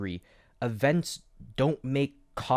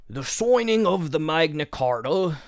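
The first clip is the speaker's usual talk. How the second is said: put-on a clumsy first try at a posh British accent, higher and louder; bad British accent; 5 semitones higher, 4 dB louder than usual. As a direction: fumble the British accent as a warm-up. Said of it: "that's not a good British accent".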